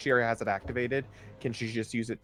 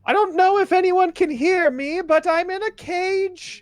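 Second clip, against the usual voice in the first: screamed out; 13 dB louder than usual. Acted. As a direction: scream it out to be heard through the bars. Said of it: "she also screams out".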